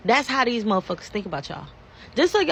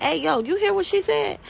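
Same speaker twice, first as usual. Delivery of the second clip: higher, incredulous friend; quoting her friend; 9 semitones higher than usual. Retold or imitated. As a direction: call out a friend in disbelief.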